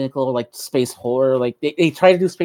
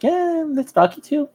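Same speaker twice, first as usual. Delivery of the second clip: unenthused shrug; 13 semitones higher, slower than usual. Retold or imitated. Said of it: "in a way that's like".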